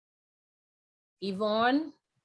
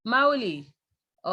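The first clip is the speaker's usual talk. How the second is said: ordinary delivery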